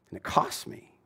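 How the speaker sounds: a soft whispered admission. Real, not acted. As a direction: breathe it out as a whisper. Regretful aside after confession.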